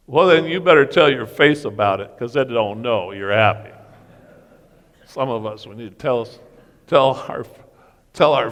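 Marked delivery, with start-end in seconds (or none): 0.1-3.7 loud quip. Retold or imitated. Telling the teenager to show it, loud jab. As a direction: land the joke with a loud jab.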